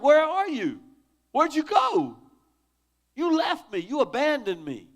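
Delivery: anguished cry. Retold or imitated. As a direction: cry out like someone abandoned by God. Voicing psalmist crying to God.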